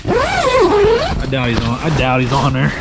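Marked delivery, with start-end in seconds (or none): 0.1-1.8 much higher, slow drawn-out sing-song; mock doubtful. Real, not acted. Angler doubting the fish is hooked, teasing. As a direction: stretch it out in a teasing high voice.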